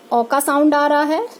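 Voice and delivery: ordinary delivery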